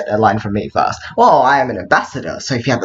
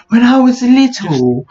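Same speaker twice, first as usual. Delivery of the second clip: put-on old man voice; 10 semitones higher, 4 dB louder, slower than usual. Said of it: "the point where I sound old".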